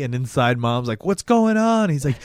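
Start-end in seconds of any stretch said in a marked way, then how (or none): 1.0-1.9 high, loud mom calling in; mock a mother shouting through the door; imitated worried mom. Mom voiced calling into room.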